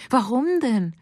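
high-pitched startled cry. A narrator's reading. Lucille hearing of Benoit's arrest, crying out. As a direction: cry out in sudden dismay. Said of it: "Lucille schrie auf".

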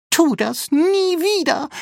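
very high, indignant robot voice; squeaky scolding. A narrator's reading. Rambo scolds the prankster, high indignant squeal. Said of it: "schimpfte Rambo".

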